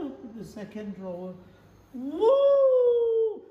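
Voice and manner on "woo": long, high, loud "woo"; amazed whoop. Acted. Opening the second drawer for viewers, amazed whoop. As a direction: let out a big held whoop.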